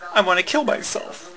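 nervous laugh through the words. Real, not acted. Telling the policeman she wants to die, nervously laughing. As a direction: laugh it out uneasily. Retold confession to cop, laughing.

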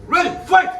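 loud, punchy fight call; fighting game announcer. Real, not acted. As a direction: bark out the start of the round. Referee starts the bout.

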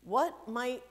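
ordinary talk, nothing marked

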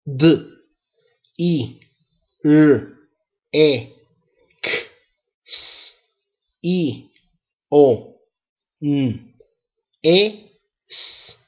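each letter as its Spanish sound, D "duh", I "ee", R "er", separated by pauses. Shown as a demonstration. Teacher spelling out direcciones for learners, separate letter sounds. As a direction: spell by isolated sounds, one at a time. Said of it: "Now, let's spell it out".

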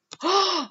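short, high gasp; real quick gasp of discovery; sharp little intake of breath; sudden gasp. Real, not acted. Finds what they searched for.

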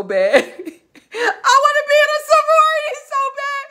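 half sobbing, half laughing, begging; laugh-crying plea. Real, not acted. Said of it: "laughing and crying wish".

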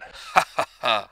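a loud, scornful burst of laughter; loud derisive laugh. Acted. Svidrigailov laughing at Raskolnikov's sudden virtue, loud and scornful. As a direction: laugh him off with open contempt.

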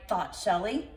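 ordinary delivery, nothing marked

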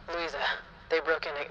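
quiet filtered line, cut off; voice effect test. Shown as a demonstration. Streamer trying out voice effects for viewers, filtered voice. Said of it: "no, I don't like that".

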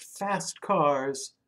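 ordinary delivery, nothing marked

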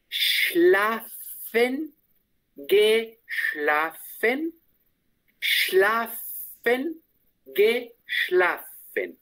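very slow "Schlafen, geschlafen", syllable by syllable with long "sh"; unhurried demonstration. Shown as a demonstration. Teacher says "Schlafen, geschlafen" twice for student, slowly and deliberately. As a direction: take your time over every syllable of "Schlafen, geschlafen". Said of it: "No tan rapidito".

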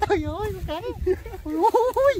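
real high-pitched gasp of amazement; startled exclamation. Real, not acted. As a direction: cry out in sudden amazement, high. Startled by the big snake. (kêu lên sửng sốt, giọng cao vút)

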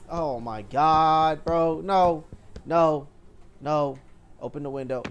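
ordinary talk

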